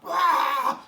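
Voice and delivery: high, loud startled "Wah!"; startled yelp. Retold or imitated. John startled by the two captains, loud yelp. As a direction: yelp like you just got caught off guard. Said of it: "he's like".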